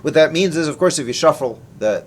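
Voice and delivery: ordinary talk, nothing marked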